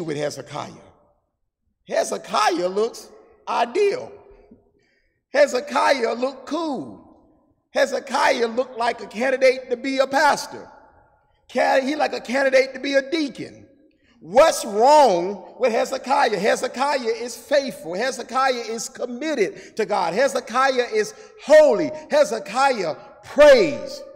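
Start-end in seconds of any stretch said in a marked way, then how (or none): 1.9-13.7 building sermon praise. Real, not acted. Preacher makes the case for Hezekiah, emphatically. 14.2-16.4 loud, slow, drawn-out challenge; emphatic preaching question. Real, not acted. Preacher challenges the church about Hezekiah, forcefully. 16.4-24.0 emphatic list building to a loud peak; climactic preaching. Real, not acted. Preacher lists Hezekiah's virtues to the church, with fervour.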